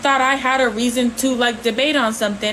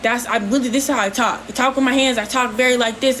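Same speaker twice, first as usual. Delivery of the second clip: ordinary delivery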